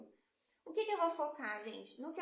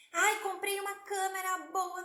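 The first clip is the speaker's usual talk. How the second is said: higher, louder mock brag about the camera; mock excited client; 5 semitones higher, 6 dB louder than usual. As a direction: play the gadget-proud client. Mock client bragging, high loud.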